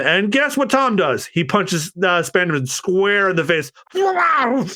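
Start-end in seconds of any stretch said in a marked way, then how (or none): 3.9-4.7 high shouted yelp of pain; mock yelp from a punched villain. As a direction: cry out as the punch lands. Spandam punched.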